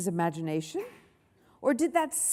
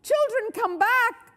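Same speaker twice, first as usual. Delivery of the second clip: high, urgent call; shouting after children; 13 semitones higher, 5 dB louder than usual. A narrator's reading. Miss Battleaxe to fleeing children, high shout. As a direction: holler pleadingly to bring them back. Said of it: "shouted Miss Battleaxe".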